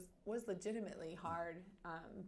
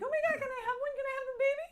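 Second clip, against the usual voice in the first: much higher, louder squealing excitement; imitating sister's excitement; 10 dB louder, much faster than usual. Retelling her sister Kelly's ultrasound reaction, squealing mock excitement. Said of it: "crazy reaction where she's like".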